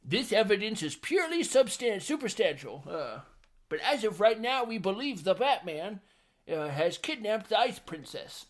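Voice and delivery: high, mock-official announcement; silly commissioner voice. Host playing the commissioner addressing the press, hammed-up official voice. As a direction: declare it high and hammy, stumbling over long words.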